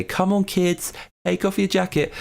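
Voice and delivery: a hint of a coaxing official; mock government plea. Acting the government's plea to citizens.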